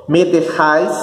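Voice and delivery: "dejáis" ending in a stressed -JÁIS; "dejáis" with the I clearly sounded; added I. Shown as a demonstration. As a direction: glide from A into I in "dejáis". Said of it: "acrescenta um I".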